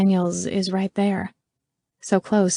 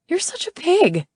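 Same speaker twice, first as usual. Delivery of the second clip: high-pitched, indignant jab; disgusted insult; 11 semitones higher than usual. A narrator's reading. Merkel insults Jerry as they argue, disgusted. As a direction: fling the insult with a swoop up.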